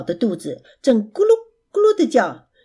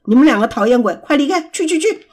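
louder and higher, chasing them out; roaring; 3 semitones higher, 4 dB louder, faster than usual. Acted. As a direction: bellow at the pests to get out. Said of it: "贝熊大吼". (愤怒地大吼赶人)

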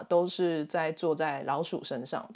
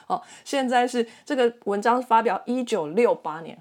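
ordinary talk

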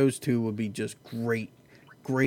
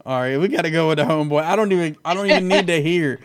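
excited; 4 semitones higher, 5 dB louder, faster than usual. Real, not acted. Hyped about hometown fighter.

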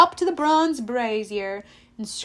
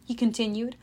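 ordinary delivery, nothing marked

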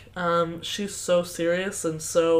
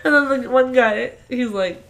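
ordinary talk

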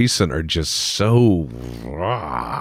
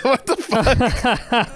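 high, slow, laughing what the fuck; laughing disbelief; much slower than usual. Real, not acted. Reacting to the northern Bond bit, laughing disbelief. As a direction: stretch it out through real laughter. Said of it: "Oh, God".

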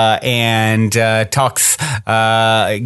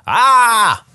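high mock scream as a mountain echo; storyteller imitating an echoed cry; 11 semitones higher than usual. A narrator's reading. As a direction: echo back a high, drawn "Ah!". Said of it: "hear a voice from the mountain".

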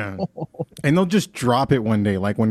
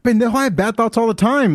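mom voice; 10 semitones higher, 4 dB louder than usual. Retold or imitated. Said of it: "She goes".

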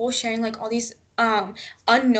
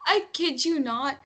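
normal talking voice

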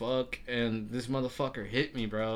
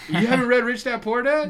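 high and loud astonished question; can't believe he hasn't read it; real surprise; shocked disbelief; 11 semitones higher, 9 dB louder than usual. Real, not acted. Friend never read book.

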